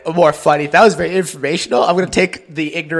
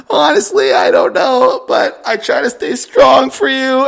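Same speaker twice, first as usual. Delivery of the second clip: much higher, louder, teary mock whimper; mock brave sobbing; 9 semitones higher, 4 dB louder, slower than usual. Daughter telling dad she stays strong, mock teary. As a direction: fake a brave face through tears. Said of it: "she's going".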